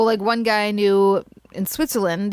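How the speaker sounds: ordinary talk, nothing marked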